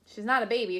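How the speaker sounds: usual speaking voice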